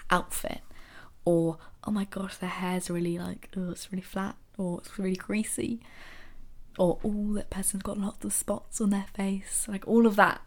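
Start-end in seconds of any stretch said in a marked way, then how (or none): 1.8-4.4 hushed catty gossip, barely voiced; mock gossip whispered to a friend; gossipy whisper. Catty gossip about someone's looks. 4.8-5.8 hushed catty gossip, barely voiced; mock gossip whispered to a friend; gossipy whisper. Catty gossip about someone's looks. 7.0-9.7 hushed catty gossip, barely voiced; mock gossip whispered to a friend; gossipy whisper. Catty gossip about someone's looks.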